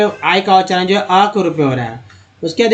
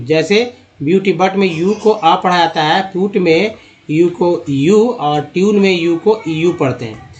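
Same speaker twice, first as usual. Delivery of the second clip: ordinary delivery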